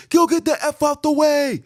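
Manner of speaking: high, loud mock driver's yell; retold yell. Driver yelling at him in the street, high and loud. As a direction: bark it like an angry driver out the window. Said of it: "I get yelled at".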